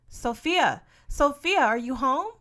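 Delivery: loud high call through the door. A narrator's reading. Berta calling Sophia through the front door, loud. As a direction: call her name from outside.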